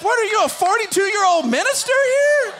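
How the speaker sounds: loud, high-pitched astonished question; baffled dad, voice shooting up; mock incredulous. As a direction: exclaim in comic disbelief. Mock disbelief at his son.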